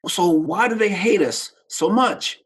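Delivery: put-on high, slow plea of a young boy; child's question. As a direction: ask it as a bewildered child. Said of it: "I said".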